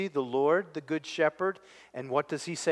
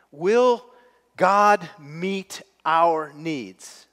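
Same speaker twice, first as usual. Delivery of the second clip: slow drawn-out question; 3 semitones higher, 6 dB louder, much slower than usual. Acted. Preacher drawing out question slowly.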